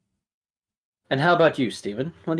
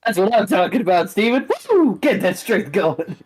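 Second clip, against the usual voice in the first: cheering; 9 semitones higher, 5 dB louder than usual. Real, not acted. GM cheering on player.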